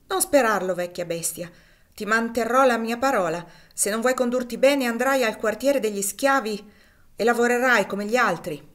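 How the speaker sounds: loud, harsh threat to a servant; angry threat. A narrator's reading. Legré threatening Cassie with the slave quarters, loud and angry. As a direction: lay down the law loudly.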